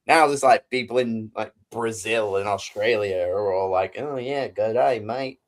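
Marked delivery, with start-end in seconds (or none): 4.0-5.4 put-on a light, jokey Australian accent. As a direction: toss off a quick mock Australian "good day, mate". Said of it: "the Brazilian accent right there".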